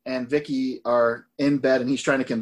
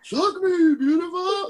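much higher, cocky mock-yelled pickup line; imitating a loud cocky yell; slower than usual. Imitating Stifler yelling the line at the party, cocky and high. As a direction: belt it out like a smug party guy. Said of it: "says it really loudly".

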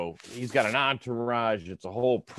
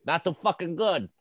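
higher, put-on voice for a rude retort; mock heckler retort; 7 semitones higher than usual. Retelling the heckler answering De Blasio, mock retort. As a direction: snap it back like a street heckler.

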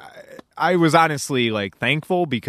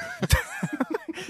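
high squealing laugh; 7 dB quieter than usual. Real, not acted. Laughing at own joke.

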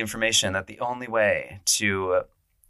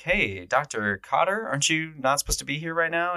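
higher-pitched imitation of a puzzled nurse; questioning nurse; 7 semitones higher than usual. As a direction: lightly voice a nurse querying a colleague. Nurse questioning the doctor, voiced.

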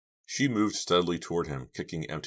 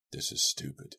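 hushed, breath-only grumble; muttering; 3 dB quieter than usual. A narrator's reading. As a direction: mutter it under your breath. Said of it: "he muttered".

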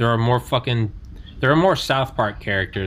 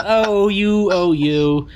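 high, louder flirty mock; flattered lady; 8 semitones higher, 4 dB louder, much slower than usual. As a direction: coo like a flattered older lady.

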